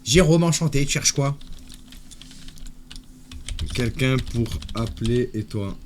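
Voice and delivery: ordinary talk, nothing marked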